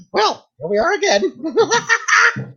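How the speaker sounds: very slow, high, laughing Joker voice; Joker impression. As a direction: drawl it out like a giggling villain. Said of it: "Imagine the Joker, he's like".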